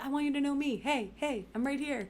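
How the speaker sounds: high, pleading bid for attention; eager high voice. Retold or imitated. Acting her eager chat messages.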